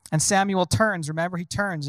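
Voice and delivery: ordinary talk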